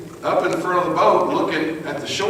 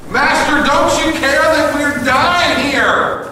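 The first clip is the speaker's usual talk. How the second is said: desperate full-voiced yell, high and drawn out; excited yelling; 13 semitones higher, 8 dB louder, slower than usual. Disciples yelling to their sleeping master in the storm, frantic high shout. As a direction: cry out over a storm in panic. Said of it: "they're yelling. And from excitement".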